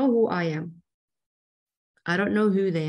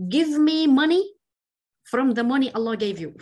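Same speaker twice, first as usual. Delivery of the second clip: rude demand; 5 semitones higher, 5 dB louder than usual. Retold or imitated. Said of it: "He says".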